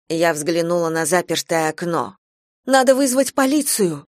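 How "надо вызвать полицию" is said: high-pitched, alarmed demand; urgent plea in panic; panicked urging. A narrator's reading. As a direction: urge him to act, voice raised in pitch. Mother panics, urges calling police.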